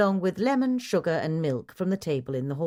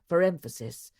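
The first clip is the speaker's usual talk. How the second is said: usual speaking voice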